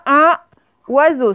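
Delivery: "Un", pause, "oiseau", no liaison; separated words. Shown as a demonstration. Teacher dictates two separate words, pause between "Un" and "oiseau". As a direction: split "Un" and "oiseau" with a clear gap. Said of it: "Ce sont deux mots séparés".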